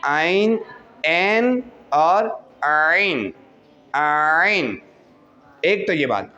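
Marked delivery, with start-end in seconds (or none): 0.1-4.8 आइन throaty, ऐन flat, आइन throaty again; very slow आइन against ऐन; ain contrast. Shown as a demonstration. Teacher contrasts both sounds for students, slow deliberate. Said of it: "कितना फ़र्क है अदायगी में".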